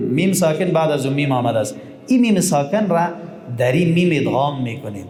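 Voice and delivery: ordinary delivery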